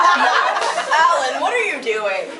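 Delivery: calls his name through real laughter; laughing while asking what he's doing. Real, not acted. Laughing at Alan striking a silly pose, real laughter.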